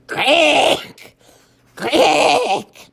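high, loud elephant creak; elephant noise. Acted. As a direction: squawk it like an elephant. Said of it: "Try more ick in your".

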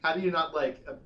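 ordinary delivery

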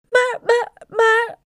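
high, loud, stammered cry of shock. A narrator's reading. Wife at the sight of her shrunken husband, crying out. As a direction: stutter it out, shrill with alarm. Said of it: "riep mevrouw Krijtjes".

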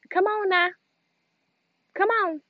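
high-pitched parental summons; imagined mom hurrying a child; parent calling a child. Pretend. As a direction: call a dawdling kid along. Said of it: "I can't wait to be like".

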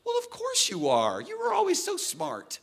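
high, gushing mock teacher, rising to a squeal. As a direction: play the teacher fawning over the grown-up student. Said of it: "And she's like".

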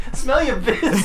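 laughing. Pretend. Joke said in silly voice.

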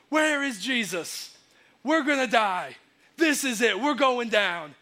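high, loud mock panic; freaking out. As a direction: wail it like a doomed disciple in a storm. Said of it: "disciples are freaking out".

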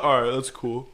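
laid-back cop. Retold or imitated. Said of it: "He didn't say it like that".

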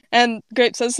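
usual speaking voice

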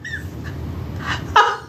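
breathless silent laughter; silent wheeze laugh. Real, not acted.

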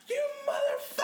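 high, shrieked mock girlfriend rage; retold furious scream. Storyteller quoting girlfriend getting ready for work, mock-furious shriek. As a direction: imitate an enraged girlfriend's scream. Girlfriend finding mess, acted out.

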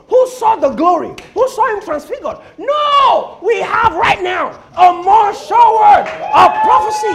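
loud, high, emphatic preacher's cry; full-force preaching. Acted. Preacher correcting believers about the surer word, full-force preaching. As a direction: proclaim it at the top of your voice.

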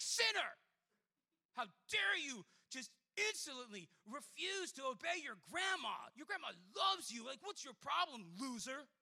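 loud, high outraged shout with big stresses; harsh rebuke. Acted. Preacher rebuking a kid who disobeyed grandma, harsh shout. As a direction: berate them like a furious accuser.

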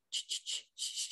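soft breathy shushing sound. Retold or imitated. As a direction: hush the kid with a quick shh. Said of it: "She's like".

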